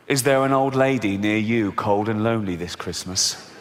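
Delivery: low, grave TV appeal voice; charity advert voice. Retold or imitated. TV charity appeal to viewers at Christmas, mock sombre. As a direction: imitate a sombre advert, low and falling away. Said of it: "This runs every Christmas day".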